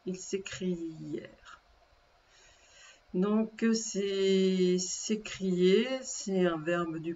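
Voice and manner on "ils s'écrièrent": "s'écrièrent" drawn out softly on the ending; slow dictation. Shown as a demonstration. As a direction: repeat "s'écrièrent" slowly and quietly, stretching "-ièrent". Conjugation repeated slowly for spelling. (« s'écrièrent » répété doucement, en étirant la fin)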